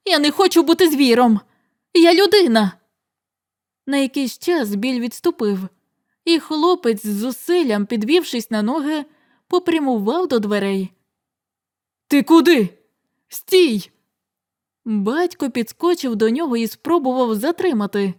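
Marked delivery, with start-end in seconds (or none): 0.1-2.7 rising to a higher, louder cry; desperate refusal. Acted. Young man refusing the beast, crying out. 12.1-13.9 calling to stop. Acted. Father stopping his son leaving, shouting.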